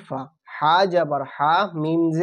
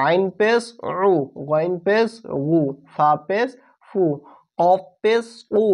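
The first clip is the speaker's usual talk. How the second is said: normal talking voice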